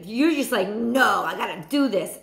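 normal talking voice

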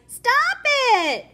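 very high, loud protest; high shout. Acted. Teased fish shouts at the fisherman, high and loud. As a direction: cry out to make it stop.

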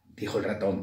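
usual speaking voice

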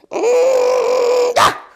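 high loud muffled cry bursting open; muffled cry then ah. A narrator's reading. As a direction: cry out as the jaw springs free. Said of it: "Pongwithy gave a cry".

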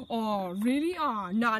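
normal talking voice

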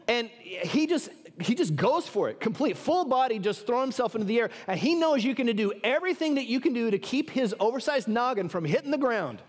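ordinary talk, nothing marked

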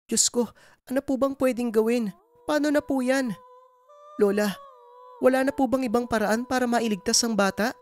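high-pitched anguished woman's voice; Nanay Dolor, distressed pleading. Acted. Woman begs healer for a way to save baby, distressed. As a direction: beg desperately for any other way.

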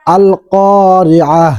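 "আল কারিআহ" ending in a stopped h, slow; pausal form. Shown as a demonstration. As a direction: recite "আল কারিআহ" stopping on the final h. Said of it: "আবার". ("আল কারিআহ" থেমে হ দিয়ে শেষ)

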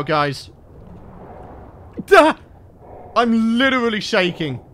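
ordinary delivery

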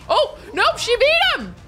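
shocked into a happy yelp; surprised cheer. Real, not acted. Player surprised her sim won the duel.

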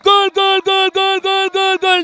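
high, chanted goal cry; goal call. Real, not acted. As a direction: chant the goals, excited. Third goal scored.

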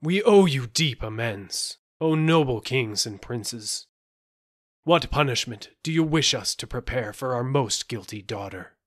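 loud, heavy lament; groaning king. A narrator's reading. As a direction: groan out a formal apology. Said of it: "said with a deep groan".

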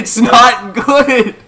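high, slow, loud protest; exasperated shout. Real, not acted. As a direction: yell it, fed up with them. Yelling at friends over impressions.

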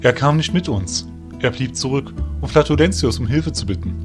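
downcast answer; saddened. A narrator's reading. Ferry telling of the one left behind, saddened. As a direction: report the absence glumly. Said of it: "antwortete Ferry betrübt".

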